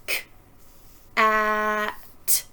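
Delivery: "K, a, t." each sound said apart with pauses; sounding out words. Shown as a demonstration. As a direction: sound out "K, a, t." slowly, one sound at a time. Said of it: "Watch while I show you".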